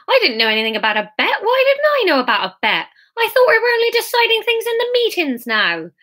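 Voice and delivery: very high-pitched, aggrieved character voice; high indignant protest. A narrator's reading. Character protests being left out.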